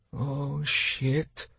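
soft, dismayed aside; inner thought. A narrator's reading. Captain in trouble thinks to himself, softly dismayed. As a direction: think it quietly to yourself in dismay. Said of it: "Leonov thought".

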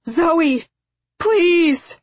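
loud, high desperate plea; screamed. A narrator's reading. Father begs his daughter to turn, screaming. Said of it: "he screamed".

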